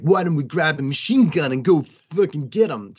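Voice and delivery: hammed-up bad Italian accent; badly faked, over-the-top Italian accent; huge Italian accent. Podcast host mimicking a movie character's plan, bad Italian accent. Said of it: "he puts on his huge Italian accent".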